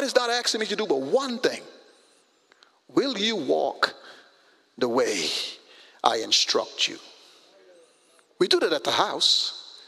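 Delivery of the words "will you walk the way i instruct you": slow emphasis. Real, not acted. Slow emphatic question to congregation.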